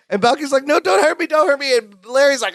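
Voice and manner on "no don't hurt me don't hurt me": high, panicky retold plea; mock frightened pleading. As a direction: play a scared man begging off, pitched up. Retold Balky begging Larry.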